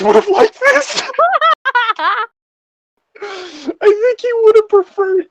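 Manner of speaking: cracking up mid-sentence; laughing. Real, not acted. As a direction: voice breaks into high laughter. Laughing while reading.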